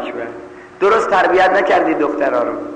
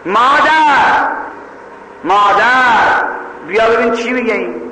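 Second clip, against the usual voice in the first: long, high, drawn-out calls of mother; stretched high cry of mother, like a chant; girl crying out; 5 semitones higher, slower than usual. Retold or imitated. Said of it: "یه وقت دختره داد زد".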